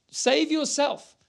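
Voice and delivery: lightly mocking ruler's jibe; scoffing rulers. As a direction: sneer it out slowly. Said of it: "The rulers are scoffing at him".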